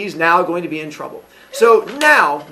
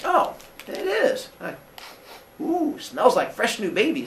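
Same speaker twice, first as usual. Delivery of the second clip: put-on sniffing the baby; 4 semitones higher, 6 dB quieter than usual.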